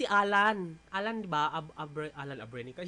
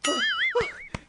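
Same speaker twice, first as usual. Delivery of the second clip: out of breath gasp; 3 dB louder than usual. Real, not acted. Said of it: "having hard time breathing".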